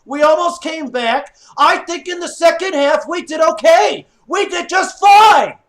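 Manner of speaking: loud, high, insistent rant; exasperated shouting. Real, not acted. Fan to his losing team, shouting in frustration. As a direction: shout it at the team, driving each point home.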